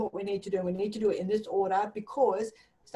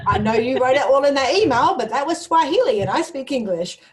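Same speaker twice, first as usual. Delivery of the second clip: louder, indignant staff voice, ending in a laugh; half-laughing mock outrage at the boss; mock exasperated retort; 5 semitones higher, 10 dB louder than usual. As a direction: play the fed-up colleague telling her off. Voicing staff complaint.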